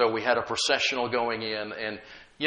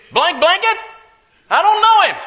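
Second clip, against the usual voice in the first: loud, high furious denial; cussing denial; 9 dB louder than usual. Acted. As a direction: spit it out like a cornered man swearing. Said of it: "he cussed just to make emphasis".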